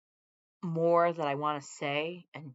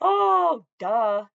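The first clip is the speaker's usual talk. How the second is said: much higher, louder exaggerated "duh"; mock outburst; 7 semitones higher, 14 dB louder than usual. Real, not acted. Vlogger scoffing at a poll number, loud and high. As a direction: blurt it out in scornful disbelief.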